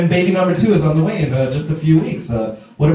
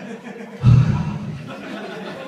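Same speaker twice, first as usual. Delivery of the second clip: long breathy sigh of disappointment; weary sigh; 4 dB quieter than usual. Acted. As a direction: let out a deflated sigh. Let down by the same gift.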